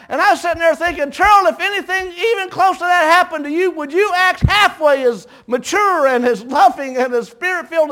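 high, loud preacher's cry; soaring revival preaching; preaching at full force. Acted. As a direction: lift it into a ringing sermon climax.

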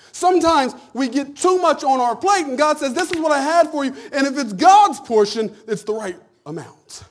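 loud, high preacher cadence; preaching. Acted. Pastor urging the church to be content, loud preaching. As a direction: preach it to the congregation.

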